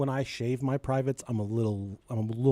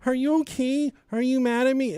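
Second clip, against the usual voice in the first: higher, pleading mock worry; imitating anxious wife; 8 semitones higher than usual. Husband mocking wife's old fretting, higher pleading. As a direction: whine it like she used to. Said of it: "You used to be like".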